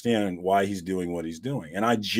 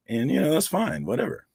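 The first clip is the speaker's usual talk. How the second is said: usual speaking voice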